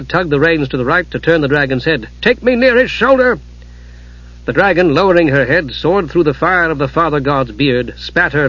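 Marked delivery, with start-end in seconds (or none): 2.2-3.4 high urgent shout. Acted. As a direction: call it over the roar. Steel calls to dragon in battle.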